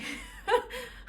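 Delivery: a quick high laugh at her own joke; short laugh. Real, not acted. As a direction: laugh it off lightly.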